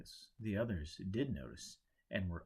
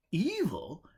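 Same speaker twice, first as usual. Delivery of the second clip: "Evil?" jumps up high and loud in disbelief; an outraged, startled echo of the word; shocked protest; 9 semitones higher, 7 dB louder than usual. Acted. Henry protests to Joe in loud disbelief. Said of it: "Henry protested".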